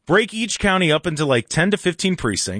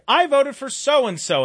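megaphone proclamation; 8 semitones higher, slower than usual. Acted. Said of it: "set up a giant megaphone".

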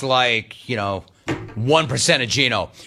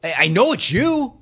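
pleading disbelief; 9 semitones higher than usual. Retold or imitated. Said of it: "I'm like".

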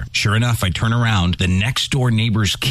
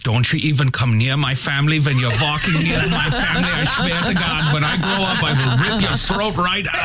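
high-voiced threatening puppy; hammed-up high puppy menace; German Shepherd puppy; 8 semitones higher than usual. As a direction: play a tiny guard dog making big threats. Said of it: "He's like".

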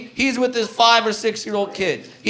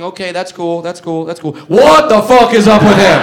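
put-on kid's alarmed thought; 2 semitones lower, 6 dB louder than usual. Kid's inner thoughts voiced.

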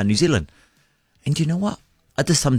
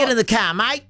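high, rising Aussie gangster voice; Australian gangster accent; 13 semitones higher than usual. Pretend. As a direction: play a tough Aussie gangster. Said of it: "come back and get in character for us".

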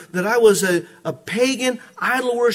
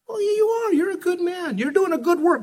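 insistent flatterer; 10 semitones higher than usual. Retold or imitated. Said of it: "I had a guy argue with me".

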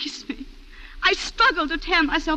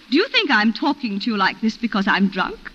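ordinary delivery

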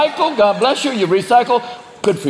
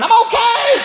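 burning driver. Acted. Nascar driver climbing out of flames, shouting. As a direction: yell cheerfully while on fire. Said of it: "a guy get out with his pubes on fire going".

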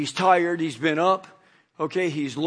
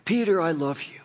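Jesus gently comforting Peter; tender compassion; 3 semitones lower, faster than usual. Pretend. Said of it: "look at him with love and compassion".

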